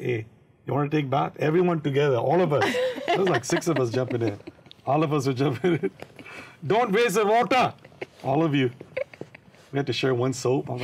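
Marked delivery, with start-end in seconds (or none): 0.0-2.6 put-on louder grandpa, Indian accent, a bit bossy; grandpa's Indian accent. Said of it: "He said". 6.7-8.7 put-on higher, louder scold in an Indian accent; grandpa's Indian accent. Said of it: "He said".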